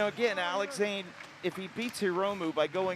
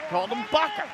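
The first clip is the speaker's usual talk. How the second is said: excited; 10 semitones higher, 5 dB louder, faster than usual. Real, not acted. Excited commentator call.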